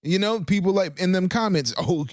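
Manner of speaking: high whiny mock accuser; high, indignant mock critic voice; mocking commenters. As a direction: squeak out a nagging complaint. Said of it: "people were like".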